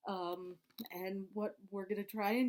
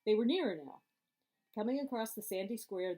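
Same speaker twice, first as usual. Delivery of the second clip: plain reading voice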